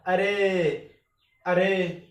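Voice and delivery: अरे called loud with the final ए held long; prolated vowel. Shown as a demonstration. Teacher showing a triple-length vowel, अरे drawn out. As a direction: draw out the end of each अरे. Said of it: "तीन गुना समय लगता है".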